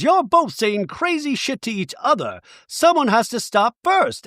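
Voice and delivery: high-pitched mimicked plea; hammed-up high protest; mock scolding. Imitating Bronwyn pleading in quarrel.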